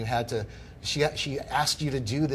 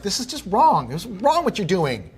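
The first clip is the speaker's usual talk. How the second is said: higher, louder indignant protest; 8 semitones higher, 6 dB louder than usual. Retold or imitated. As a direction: stand up to her, voice raised. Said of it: "And I was like".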